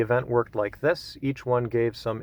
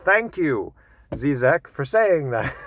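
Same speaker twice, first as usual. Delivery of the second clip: mock grateful; 4 semitones higher, 4 dB louder, slower than usual. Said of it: "And I'm like".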